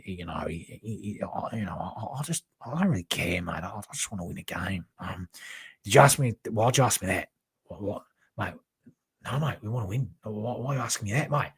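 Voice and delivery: Ange Postecoglou impression. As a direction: mumble dejectedly in an Australian accent like a beaten manager. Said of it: "very like Ange Postecoglou".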